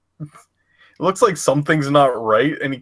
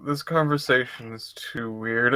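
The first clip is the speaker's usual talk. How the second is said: softer, lower aside; quiet bail-out; 3 semitones lower, 7 dB quieter than usual. Acted. Patrick leaving a weird conversation, quiet aside. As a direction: say it under your breath while backing out.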